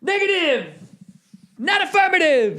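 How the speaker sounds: high, strained falsetto refusals; mock refusing singer. Singer squealing no at the crowd. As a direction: squeal the no's like a diva.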